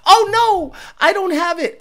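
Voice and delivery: high-pitched little lament; mock dismay. Tuner missing his Mo Streeta clip, high mock dismay. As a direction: lift it high in playful dismay.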